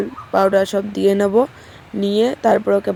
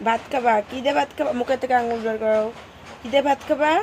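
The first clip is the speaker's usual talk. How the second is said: mother cooing to her daughter about food, raised playful voice; 2 semitones higher, 3 dB quieter than usual. Real, not acted. Asking toddler what she'll eat.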